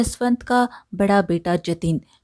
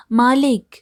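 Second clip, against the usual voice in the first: second call, higher and a bit louder; 4 semitones higher, 4 dB louder than usual. A narrator's reading. Laborer calling master again, higher louder call. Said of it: "बाहर से पुकारता है".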